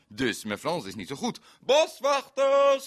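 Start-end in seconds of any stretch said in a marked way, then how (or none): 1.6-2.9 long, high shout of Boswachters; drawn-out high call, louder. Retold or imitated. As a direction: call across the street for the foresters. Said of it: "riep ik".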